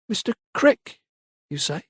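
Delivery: a lightly voiced servant girl, caught off guard; surprised maid. A narrator's reading. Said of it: "Lydia looked up".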